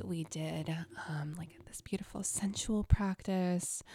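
breathy confiding whisper; hushed whisper. Real, not acted. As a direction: lean in and whisper the private part. Describing an intimate practice.

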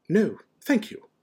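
high, slow, cold refusal. A narrator's reading. McGonagall refusing a lemon drop, cold. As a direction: decline with pointed politeness. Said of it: "said Professor McGonagall coldly".

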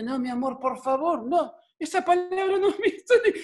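high, mock-panicked pleading; acted parental alarm, rising high; flustered father. Flustered father hushing his child.

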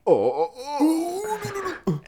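high frightened whimper; mock fear. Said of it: "they're like".

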